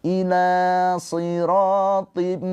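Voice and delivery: slow melodic tajwid chanting; Quranic recitation. Acted. Teacher chants the verse for students, slow and melodic.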